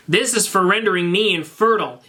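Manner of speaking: a touch of high, menacing vengeance; lightly voiced cold payback line; vengeful line.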